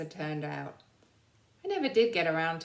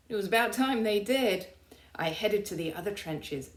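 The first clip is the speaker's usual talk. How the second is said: ordinary delivery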